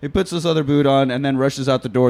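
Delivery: ordinary talk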